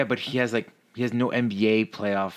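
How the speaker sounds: ordinary talk, nothing marked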